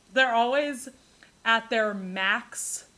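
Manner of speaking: normal talking voice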